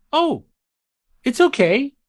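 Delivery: calm her down the way a gentle Santa would; kindly Santa. Retold or imitated. Santa answers the puzzled mother.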